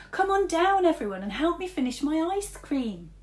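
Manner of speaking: high, loud call. A narrator's reading. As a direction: call up to the flats. Girl calling to children.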